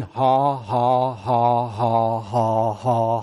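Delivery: low, monotone, deliberate ha-ha-ha; forced faith laugh on one low note; fake laugh. Shown as a demonstration. Preacher showing the congregation a laugh the body can't differentiate, fake laugh. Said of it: "a fake laugh, or we could say a faith laugh".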